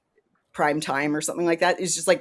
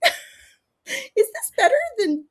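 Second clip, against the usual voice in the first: laughing while talking; faster than usual. Retold or imitated. Mock patient questioning drug.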